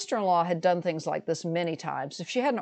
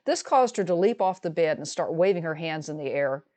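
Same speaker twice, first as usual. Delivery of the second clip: ordinary talk, nothing marked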